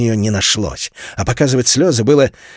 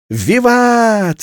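put-on high, loud boyish whoop; boy's cheer; 11 semitones higher, 3 dB louder than usual. A narrator's reading. A boy cheers the move to grandmother's, loud and high. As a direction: cheer like a warlike little boy. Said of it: "завопил воинственный Жюль".

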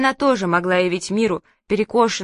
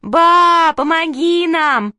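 high, loud, drawn-out cry for help; frightened yell; 9 semitones higher, 6 dB louder, much slower than usual. A narrator's reading. Terrified children call Grandma to save them, frightened yell. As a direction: scream for Grandma like a scared child. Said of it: "заорали мы".

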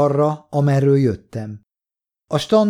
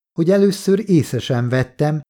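ordinary delivery, nothing marked

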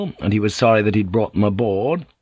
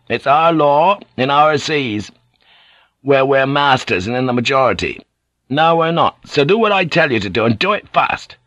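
firm, higher-pitched captain's orders; commanding Blackthorn; 5 semitones higher than usual. Acted. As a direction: assert command over a grumbling crew.

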